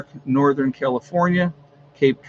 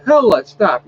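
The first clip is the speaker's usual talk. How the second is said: sharp louder "stop" at the dog; scolding the dog; 5 semitones higher, 6 dB louder than usual. Real, not acted. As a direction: snap a firm command at a whining pet.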